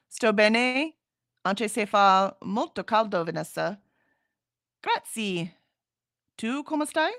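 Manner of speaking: careful Italian read with a Spanish accent, partly slow; amateur Italian. Shown as a demonstration. Host reading Italian aloud for chat, Spanish-tinged Italian. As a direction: sound out Italian with Spanish habits, a little slow. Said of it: "you wanna see how bad my Italian is".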